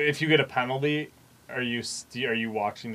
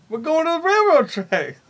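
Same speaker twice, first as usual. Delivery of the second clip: high, cackling prospector voice; cackling toothless ranting; old prospector; 12 semitones higher, 4 dB louder than usual. Pretend. Joining the prospector bit.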